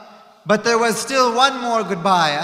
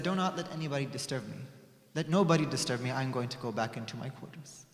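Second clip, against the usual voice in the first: much softer, quick and low; hushed request; 8 semitones lower, 14 dB quieter than usual. Acted. Prophet quietly asking not to be disturbed, hushed. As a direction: say it softly, asking for rest.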